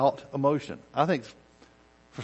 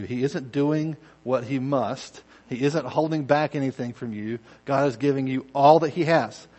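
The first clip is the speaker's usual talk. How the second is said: normal talking voice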